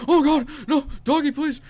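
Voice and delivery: high, loud frightened pleading; panicked begging. Real, not acted. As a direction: beg the dog in real panic.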